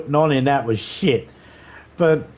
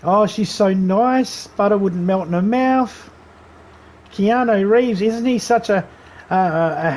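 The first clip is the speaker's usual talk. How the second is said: mock gushing; 6 semitones higher, slower than usual. Mocking people who praise Streep.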